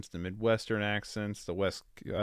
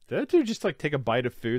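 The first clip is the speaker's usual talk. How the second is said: high, loud incredulous squeak; like you can't believe what you just saw; shocked disbelief; 5 semitones higher, 5 dB louder, faster than usual. Retold or imitated. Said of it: "I'm like".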